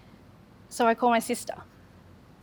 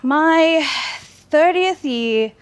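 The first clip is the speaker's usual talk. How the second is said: My, then a long pause, then thirtieth year stretched slowly and said louder; slow emphatic build-up; 5 semitones higher, 5 dB louder, much slower than usual. Real, not acted. Telling the audience her thirtieth year changed everything, slow and weighted. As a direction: lean hard on thirtieth year, drawn out. Dramatic pause marking a turning point.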